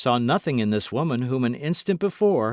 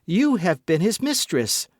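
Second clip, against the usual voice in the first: accusing; 8 semitones higher, 4 dB louder than usual. A narrator's reading. Jealous accusation.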